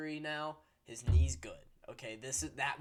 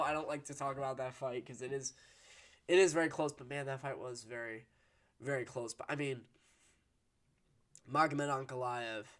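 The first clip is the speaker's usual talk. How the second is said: ordinary delivery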